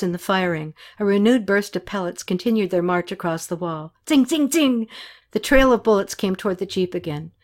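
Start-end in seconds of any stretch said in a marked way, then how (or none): 4.1-4.9 high, ringing tings; pitched-up voiced ricochets; imitated bullet hits. A narrator's reading. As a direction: patter them like bullets striking.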